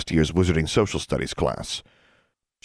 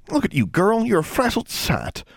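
put-on high-pitched country girl scolding; high, disapproving sisterly fussing; tut-tutting. A narrator's reading. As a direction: fuss over a younger sister in a high voice. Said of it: "Forbearance tut-tutted".

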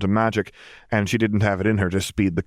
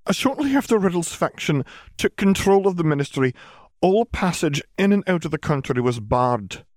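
higher-pitched formal witch; McGonagall, explaining; 10 semitones higher than usual. A narrator's reading. Witch explaining the closed border, sober higher voice.

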